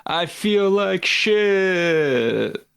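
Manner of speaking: drawn-out, high-pitched rock frontman yell, held back; half-committed high rock-singer wail. Acted. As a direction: stretch it out high like a festival rocker. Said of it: "[name] voice at Woodstock ninety-nine".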